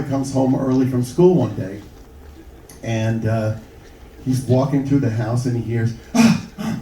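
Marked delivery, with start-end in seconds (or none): 6.1-6.8 short, high mock moans; two quick high "ah"s, hammed up; imitated sex noises. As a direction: mimic overheard bedroom gasps. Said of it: "hears all those kinds of noises".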